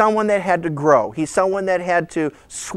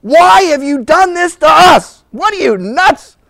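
loud, high scolding shout; angry mother; 10 semitones higher, 7 dB louder, slower than usual. Acted. Mother finding her son in the temple, hammed-up fury. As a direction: act out an exasperated mother telling off her son. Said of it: "don't think amazed was quite the look".

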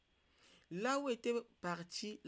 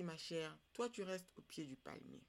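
ordinary delivery, nothing marked

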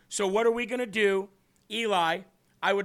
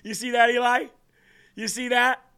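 excited; 4 semitones higher, 7 dB louder than usual. Real, not acted. Caller hung up on him.

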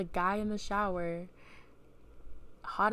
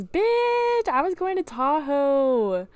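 high, loud, drawn-out squeal; real excitement; 8 semitones higher, 8 dB louder, much slower than usual. Real, not acted. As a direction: stretch it out loud in thrilled bragging. Excitedly recalling own birthday trip.

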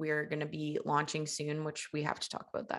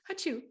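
sneezing; 9 semitones higher than usual. Real, not acted. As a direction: let out a real sneeze, achoo. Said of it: "Are you gonna sneeze?".

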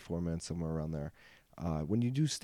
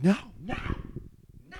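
urgent no; faster than usual. Retold or imitated. Crowd voiced stopping him.

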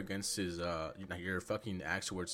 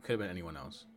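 ordinary talk, nothing marked

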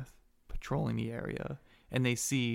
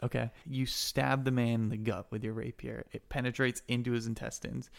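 usual speaking voice

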